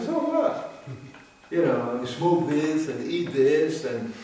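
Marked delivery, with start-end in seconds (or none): 0.0-0.8 mock so what. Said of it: "It all looks like".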